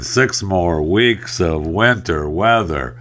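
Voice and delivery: ordinary talk, nothing marked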